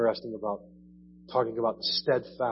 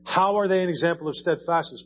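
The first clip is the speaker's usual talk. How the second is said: normal talking voice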